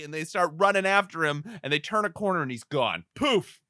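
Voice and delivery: ordinary talk, nothing marked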